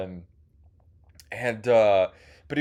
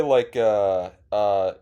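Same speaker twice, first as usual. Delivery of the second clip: ordinary talk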